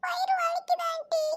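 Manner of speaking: put-on very high, small child's voice; whining child. Child tells auntie about a stomach ache, whining. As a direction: complain in a thin, high child's whine.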